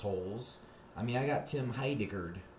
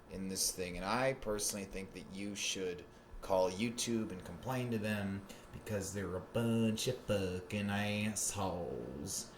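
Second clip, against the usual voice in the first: ordinary delivery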